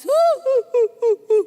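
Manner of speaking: loud, high imitated boo-hoo sobs; exaggerated wailing in short bursts; mock sobbing. Preacher mimics the woman crying harder when comforted, mock sobs. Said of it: "Makin nangis".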